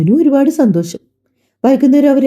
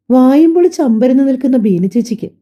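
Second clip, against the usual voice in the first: plain reading voice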